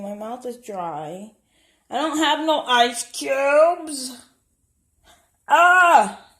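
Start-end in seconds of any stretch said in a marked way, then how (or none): 1.9-4.2 high, loud, drawn-out complaint; raised-voice moan of dismay; loud whine. Real, not acted. Complaining at finding no ice. 5.5-6.3 loud, high "ah" of frustration; short burst of a yell; frustrated yell. Real, not acted. Frustrated cry at missing ice.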